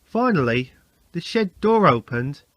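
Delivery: ordinary delivery, nothing marked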